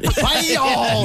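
high shouted "Bayad" at the dog; shouted scolding. Pretend. Owner yelling at the dog to get off the counter, high shout. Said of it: "pretend like he just jumped onto the counter".

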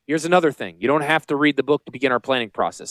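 ordinary talk, nothing marked

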